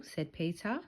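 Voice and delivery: plain reading voice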